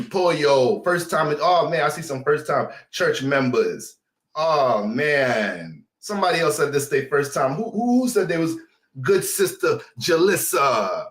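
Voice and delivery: ordinary delivery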